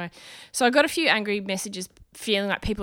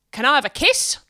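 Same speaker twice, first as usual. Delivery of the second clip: retold blunt demand, a bit higher and louder; 4 semitones higher, 5 dB louder than usual. Older man demands a kiss from comedian at merch desk, demanding. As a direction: imitate a pushy uncle asking. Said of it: "like an uncle kind of demanding a kiss".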